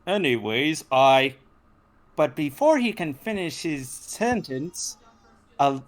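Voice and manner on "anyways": ordinary talk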